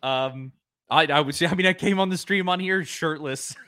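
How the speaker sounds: normal talking voice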